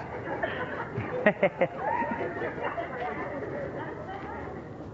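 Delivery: a long breathy laugh at his own story. Real, not acted. Preacher laughing at himself with the congregation, breathy laugh. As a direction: laugh it off, airy and unvoiced.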